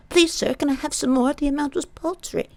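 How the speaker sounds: a light mock of a begging orphan; Oliver Twist plea. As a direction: beg like a timid Victorian orphan.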